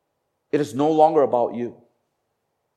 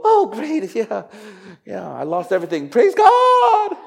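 mock chirpy voice, high and bright, breaking into a laugh; fake cheerful praise; 8 semitones higher, 5 dB louder than usual. Preacher mocking fake joy at church, hammed-up high and chirpy. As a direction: ham up a fake-happy praise. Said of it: "I'm not saying fake it and go".

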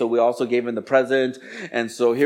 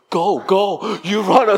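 urgent retold command; 6 semitones higher, 3 dB louder, much slower than usual. Wife urgently sends husband running.

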